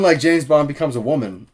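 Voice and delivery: usual speaking voice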